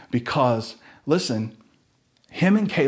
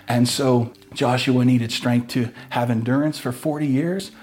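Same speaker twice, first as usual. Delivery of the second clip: normal talking voice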